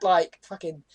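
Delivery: ordinary talk, nothing marked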